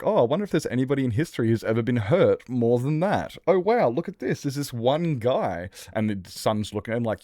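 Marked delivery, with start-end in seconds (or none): 0.0-5.7 higher sarcastic dad voice, slowing at the end; mock the son with fake wonder; smug dad. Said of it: "his dad's like".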